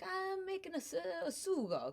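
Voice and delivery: hammed-up old-country Italian; Italian-accented Vito, proud of his sauce. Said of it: "He's like".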